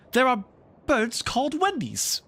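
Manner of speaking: put-on high, loud know-it-all boy; eager boy. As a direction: blurt it out proudly. Slightly explains eagerly.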